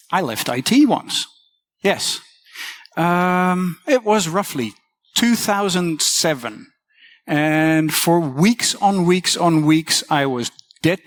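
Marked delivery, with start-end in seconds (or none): none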